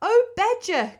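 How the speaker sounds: high, loud plea; high-pitched, desperate animal voice; crying out as Rat. A narrator's reading. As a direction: call out pleadingly in a high little Rat voice. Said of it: "cried the rat".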